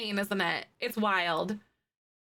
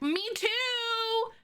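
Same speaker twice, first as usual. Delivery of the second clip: mock eager forum post; 11 semitones higher than usual. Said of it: "post in that forum and be like".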